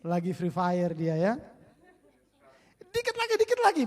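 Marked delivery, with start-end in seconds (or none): 2.9-3.8 put-on much higher stalling kid voice; whiny kid. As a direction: brush her off like a kid glued to a game. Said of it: "begitu kira-kira Rafael ya".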